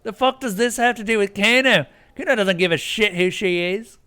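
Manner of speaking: high, loud irritated snap; angry Kano. Acted. Kano snapping at the player over the gardener. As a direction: bark it back at them.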